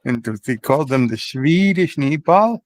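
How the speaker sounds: put-on Swedish accent, played for laughs. Claiming the Swedish family invented the meatball, in a put-on Swedish accent. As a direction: lean into a comic Swedish accent. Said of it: "You're sounding very Swedish here".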